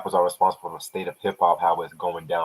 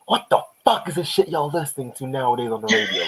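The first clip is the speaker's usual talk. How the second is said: raised, higher-pitched outburst; mock-aggressive quote, pitched up; 6 semitones higher than usual. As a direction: imitate an aggressive rapper going off. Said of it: "one of the most aggressive tracks".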